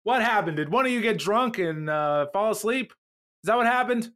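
high-pitched, accusing questions; hammed-up indignant interrogation, not a full shout; mock shouting. Ambassadors demand answers, mock indignant high voice. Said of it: "they all start shouting and screaming".

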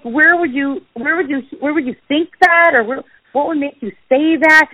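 high, baffled questioning; perplexed. Retold or imitated. Mother questioning the kid about his words, perplexed. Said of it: "I was like, perplexed".

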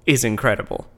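ordinary talk, nothing marked

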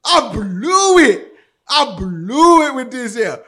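drawn-out high wail; comic lament. Retold or imitated. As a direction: moan it slowly, stretching "blew". Lamenting the ruined scene.

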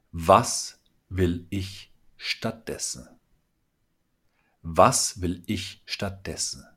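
very slow, weighing each word; slow, deliberate. Shown as a demonstration. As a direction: say it slowly so it sinks in. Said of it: "Der Satz lautet". (langsam und bedächtig)

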